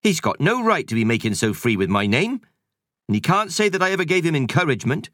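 brisk housekeeper voice; busy cleaning lady shooing kids; Missus Kersey voice. Acted. Housekeeper indignant.

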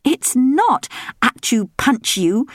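slow, high correcting princess; princess Belinda. A narrator's reading. Belinda correcting her father, slow and patient. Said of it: "said Belinda".

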